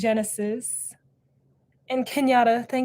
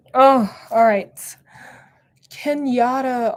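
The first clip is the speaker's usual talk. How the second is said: ordinary delivery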